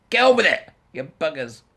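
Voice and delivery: high, loud laughing yell; laughing outburst. Real, not acted. Venting at dithering politicians, loud laughing yell. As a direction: burst out laughing and yell at them.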